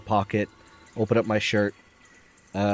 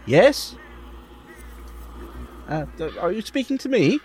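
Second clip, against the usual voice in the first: nervous character voice; 12 semitones higher than usual. Acted. Player voices his character.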